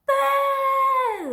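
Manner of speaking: huge, high shouted BOO; top-of-voice shout. A narrator's reading. Elmer shouting to startle the still, serious herd, full-volume shout. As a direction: blast it out at the top of your lungs. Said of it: "at the top of his voice shouted".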